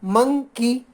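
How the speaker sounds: Monkey in two beats, मं, pause, की; pause between syllables. Shown as a demonstration. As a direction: stop on मं, then give की separately. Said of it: "एक बार में म पर रुकना पड़ता है". (मंकी को मं पर रुककर, फिर की बोलें)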